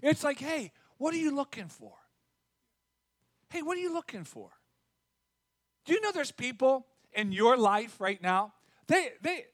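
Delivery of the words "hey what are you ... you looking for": soft, higher-pitched friendly question; light and soft, genuinely curious; gentle, inquisitive. Acted. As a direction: ask it kindly, with open curiosity. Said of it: "if you look at the connotation".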